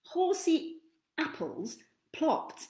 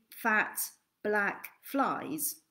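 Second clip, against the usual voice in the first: ordinary delivery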